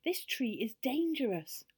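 urgent storybook yell; yelled warning. A narrator's reading. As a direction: call out a danger, stretching the words. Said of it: "yelled the elves".

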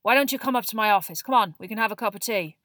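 gruff, projected invitation; gentler growl. A narrator's reading. Moody invites shaken Neville for tea, in a gentler growl. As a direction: coax the boy along gruffly. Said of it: "much lower and gentler growl".

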